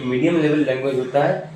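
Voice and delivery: ordinary talk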